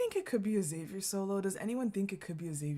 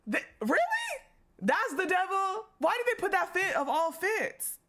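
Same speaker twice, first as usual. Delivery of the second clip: incredulous; 11 semitones higher, 7 dB louder than usual. Real, not acted. Disbelief at devil costume.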